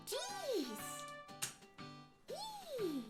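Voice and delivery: usual speaking voice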